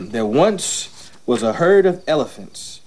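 put-on reading to a child. Dad reads story to son.

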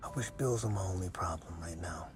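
low, hushed and weary admission; subdued confiding. Acted. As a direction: confide softly in someone you have lost. Confiding in deceased wife's vision.

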